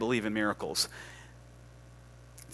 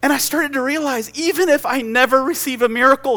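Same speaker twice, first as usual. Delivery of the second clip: high, loud, fervent testimony; impassioned preaching; 13 semitones higher, 8 dB louder than usual. Real, not acted. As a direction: pour it out at full preaching force. Preaches at full force.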